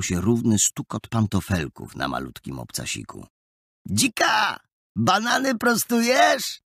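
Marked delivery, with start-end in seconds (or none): 3.9-6.6 high-pitched, drawn-out taunt; slow, raised catcall; jeering call. A narrator's reading. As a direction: call it mockingly down the street. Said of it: "wołał Adaś".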